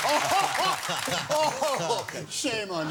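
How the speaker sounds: high, loud feigned offence; mock outrage. Guest answers host's jibe at his accent, mock outrage. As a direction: cry out as if scandalised by the jibe.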